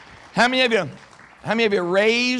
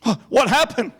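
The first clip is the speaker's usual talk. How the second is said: mock clueless student; 4 semitones higher, 4 dB louder than usual.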